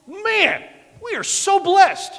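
high, loud and slow with awe; joyful exclamation. Real, not acted. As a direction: burst out in grateful joy. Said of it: "climax of sermon".

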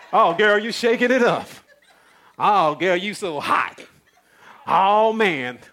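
loud, mock-gushing hype; admiring online commenters. Mimicking other Christians' comments on a girl's video, mock admiration. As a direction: hoot each compliment like an over-excited fan. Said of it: "A whole list of other Christians saying".

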